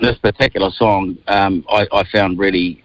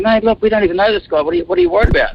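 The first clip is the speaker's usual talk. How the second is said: high-pitched retold appeal; imitated incredulous protest, high; retold pleading; 11 semitones higher, faster than usual. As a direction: plead with a mate to let it go. Retells reasoning with his offended companion.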